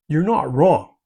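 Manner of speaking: usual speaking voice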